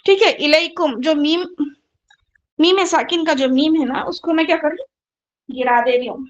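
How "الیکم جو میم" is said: ordinary talk, nothing marked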